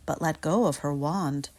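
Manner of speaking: ordinary delivery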